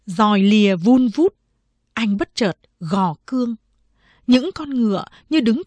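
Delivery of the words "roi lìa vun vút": ordinary delivery, nothing marked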